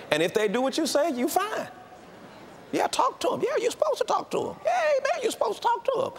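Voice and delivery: high squeaky mocking voice. Preacher mocking talking to children in falsetto. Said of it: "the fantasy is".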